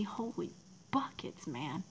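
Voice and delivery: breathy gasp of surprise, unvoiced; stunned, whispered exclamation; whispered astonishment; shocked whisper. Real, not acted. Shocked at bloodline news.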